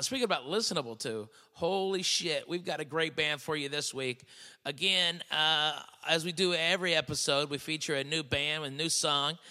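loud, upbeat show announcer. Acted. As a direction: hype up the band intro. Host introduces the week's band.

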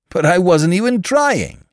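high, loud indignant protest. A narrator's reading. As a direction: protest your innocence, voice shooting up. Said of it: "he explained indignantly".

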